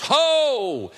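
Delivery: a loud ringing Ho; loud call. Acted. Preacher calls the congregation to stop, loud. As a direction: call it out like a herald.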